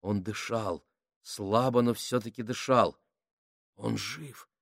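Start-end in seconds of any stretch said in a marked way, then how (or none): 3.8-4.5 hushed, barely voiced whisper. A narrator's reading. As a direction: breathe the words out softly. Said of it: "прошептал Кук".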